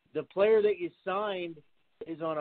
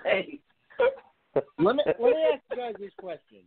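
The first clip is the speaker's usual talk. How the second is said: radio host voice; 6 semitones higher than usual. Acted.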